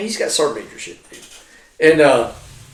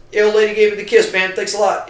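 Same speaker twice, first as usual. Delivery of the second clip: gleeful; 9 semitones higher, 6 dB louder than usual. Retold or imitated. Gleeful thanks after promotion.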